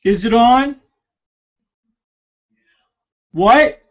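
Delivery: high, loud question shouted across the house; calling out. Real, not acted. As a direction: yell back to check the heater. Calling back and forth with Ricky.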